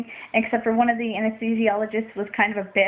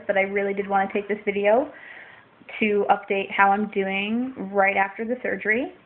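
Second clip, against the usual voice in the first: ordinary talk, nothing marked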